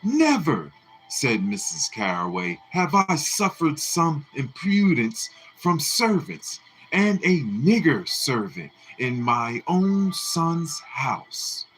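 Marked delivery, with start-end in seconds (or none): 0.0-0.7 each never higher and louder; climbing indignant repetition; outraged. A narrator's reading. Mrs Caraway in an outraged scene, rising higher each time. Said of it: "There was a scene". 2.7-11.7 outraged matron. A narrator's reading. Mrs Caraway outraged.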